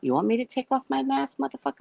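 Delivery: high, fast furious taunt; cussing him out. Retold or imitated. To the drunk man in the car, a high fast furious taunt. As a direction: spit it back at him, rising. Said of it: "I'm cussing him out".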